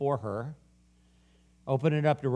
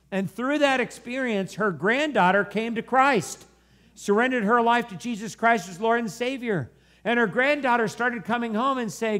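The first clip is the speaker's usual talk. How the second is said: high, raised preacher's voice; impassioned preaching; 9 semitones higher, 5 dB louder than usual. Real, not acted. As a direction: preach it with rising fervour.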